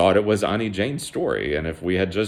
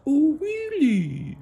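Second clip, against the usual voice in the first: very high, squeaky, slow frog; small, drawn-out cartoon falsetto; tiny-voiced frog; much slower than usual. Frog reacts to being prey.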